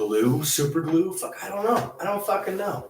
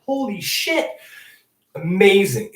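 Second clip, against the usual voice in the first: ordinary delivery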